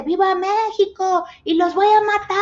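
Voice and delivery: high, full-throated war shout; patriotic battle cry. Retold or imitated. Pedro Armendáriz's character shouts at the French ships, high war yell. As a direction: yell it like a movie soldier charging the enemy. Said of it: "Y dice".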